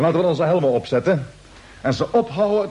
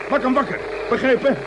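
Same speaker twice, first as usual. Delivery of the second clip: sharp order; 7 semitones higher than usual. Acted. Captain orders waking him.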